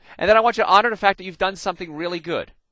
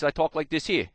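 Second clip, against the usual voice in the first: New Jersey accent. Shown as a demonstration. Speaker shows audience how he normally talked, New Jersey accent. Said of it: "the way I normally talk".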